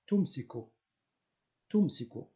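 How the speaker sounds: "Tumsiku" said slowly, each syllable clear; slow model word. Shown as a demonstration. Teacher models "Tumsiku" for students to repeat, slow. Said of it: "Donc, on dit tumsiku".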